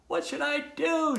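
high-pitched panicky question; high, understated scream; mild storybook scream. A narrator's reading. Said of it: "screamed Tudley".

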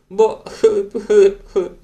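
high, loud acted sobs; sobbing. A narrator's reading. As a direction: gulp out sobs like a crying child. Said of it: "хлипала Елен".

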